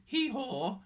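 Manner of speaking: high, loud hee-haw; playful mock bray; donkey bray. A narrator's reading. Playing donkey.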